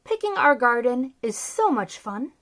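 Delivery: plain reading voice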